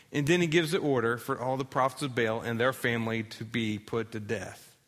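ordinary delivery

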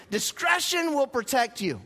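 emphatic scripture quote, "Discretion" hit hard; with emphasis. Shown as a demonstration. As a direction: declare "Discretion will protect you" with force, up in pitch. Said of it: "Say it with some emphasis".

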